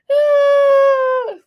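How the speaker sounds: loud high shriek of laughter; whiny cackling shriek. Pretend. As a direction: let out a big squealing cackle. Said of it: "Here, wait".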